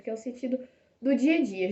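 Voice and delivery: ordinary talk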